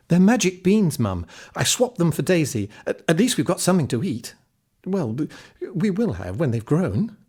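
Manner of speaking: a proud lad talking fast to justify himself; eager boy. Acted. Jack defending his swap to his mum, eagerly.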